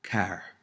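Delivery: car with the final R sounded. Shown as a demonstration. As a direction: say car, pronouncing its R at the end. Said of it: "The R is always sounded out".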